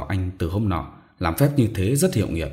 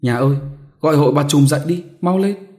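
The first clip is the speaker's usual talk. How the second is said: much higher, louder, fast urgent call; 7 semitones higher, 6 dB louder than usual. Acted. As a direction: hurry your husband with a cry. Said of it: "chị Pha gọi chồng". (gọi chồng gấp gáp, cao và to)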